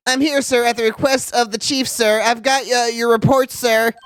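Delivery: timid nerd voice, pitch up. Acted. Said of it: "the nerd voice".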